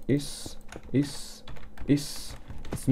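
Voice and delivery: hushed whisper to himself. Real, not acted. Gamer whispering to himself while sneaking up. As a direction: breathe the words, barely voiced. Tense moment sneaking up in game.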